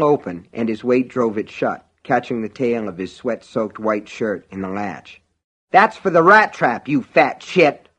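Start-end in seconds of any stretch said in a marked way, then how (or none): 5.7-7.9 loud, raised, vengeful outburst; angry cry. A narrator's reading. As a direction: cry it out angrily, pitched up. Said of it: "Norris cried".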